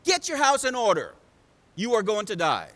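loud, emphatic verdict; forceful, declarative preacher voicing a prophet; stern prophet's pronouncement. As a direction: proclaim it like a prophet handing down judgment. Isaiah delivers God's hard message.

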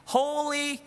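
high, ringing proclamation of scripture; crying out. Retold or imitated. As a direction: proclaim it like an angel calling out. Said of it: "one cried out to another saying".

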